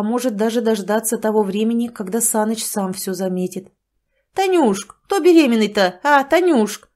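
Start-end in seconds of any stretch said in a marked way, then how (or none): 4.3-6.9 loud, higher pushy question; demanding man's voice. Acted. Sanych presses his partner, loud and demanding. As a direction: press her for the truth.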